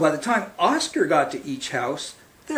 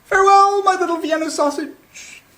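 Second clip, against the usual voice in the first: high, loud doting mother's farewell; calling out fondly; 12 semitones higher, 5 dB louder than usual. Acted. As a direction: call it across the yard like a proud mom. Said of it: "waving and calling out".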